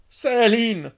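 high, loud, drawn-out cry of the name; repeated shout. A narrator's reading. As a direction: shout it desperately, stretching the vowel. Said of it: "urlò ancora l'ex cacciatore".

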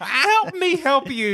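high, slow begging voice; mock desperate plea, drawn out; high pleading plea. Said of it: "I'm like".

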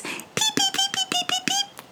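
very high, soft peeping; a mouse faking a chick's chirp; very high, soft chick peeps; chirping like a baby bird. Acted. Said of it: "chirped Brisbane".

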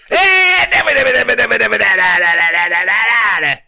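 loud, high babbled nonsense; long Italian-style jabber, falling off; hammed-up cartoon Italian babble; mock Italian gibberish. Italian babble bit returns.

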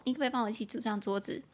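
ordinary delivery

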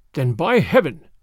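understated angry snarl; a touch of a threatening snarl. A narrator's reading. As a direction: snarl it with controlled menace. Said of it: "snarled the Russian".

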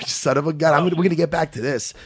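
ordinary talk, nothing marked

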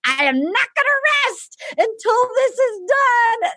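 high, hammed-up declaration; impassioned mock vow. Host vowing to get her engaged, impassioned mock vow. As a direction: proclaim it like a melodramatic hero. Acting host's grand vow.

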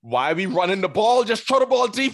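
mock fan shouting at a play call; frustrated fan rant. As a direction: yell at the coach from the couch.

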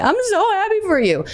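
high, tearful gush; gushing happy quote. Retold or imitated. To pregnant friend, a high gushing cry. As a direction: squeal it out with happy tears. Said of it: "I'm like".

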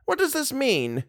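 put-on high-pitched anxious old lady; alarmed cry. A narrator's reading. Worried old mother demands an explanation, crying out high. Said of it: "she cried".

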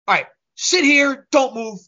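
bossy mock order; retold stern order. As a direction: imitate an angry client ordering someone about. Angry client ordering him to wait.

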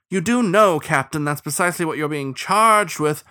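high, pointed official retort; officious starling sheriff. Acted. Starling telling captain the charge, officious. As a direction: correct him with voice lifted.

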